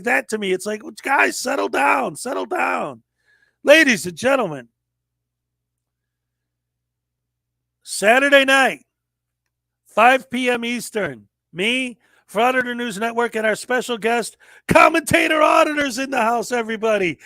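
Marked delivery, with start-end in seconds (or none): 1.0-3.0 calling out to settle. Retold or imitated. Calling out to fighting friends. 3.6-17.2 hype announcer. Acted. Hypes the show like an announcer.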